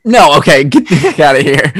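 loud mock outrage, half laughing; playful outraged shout. Real, not acted. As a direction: shout it out in joking disgust.

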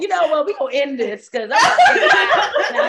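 slow, high, laughing mock complaint; laughing joke. Joking about going to the nursing home early, laughing. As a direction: drag it out while laughing.

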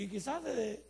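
ordinary talk

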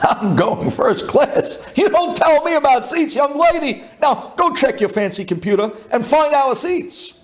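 high-pitched haughty scolding; indignant outburst. Retold or imitated. Passenger to young lady at the gate about seats, indignant. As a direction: put the clerk in her place, pitched high. Acted quarrel with gate agent.

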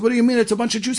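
high-pitched protesting comeback; retold protest. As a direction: answer back in acted, incredulous protest. Nazi answers the screaming officer.

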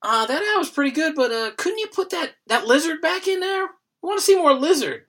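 high, mocking voice of a clueless focus group member; focus group guy. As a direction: ham up the dopey tester begging for the lizard. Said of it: "And they'll be like".

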